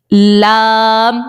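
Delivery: لام very long on a level pitch; لام with tongue edge on the gums, vowel held; articulated lam. Shown as a demonstration. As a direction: stretch لام out slowly. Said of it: "ہاں، آپ پڑھیں".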